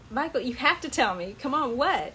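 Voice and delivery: retold urgent pleading; begging. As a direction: beg him to tell you. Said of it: "I was, like, begging with him".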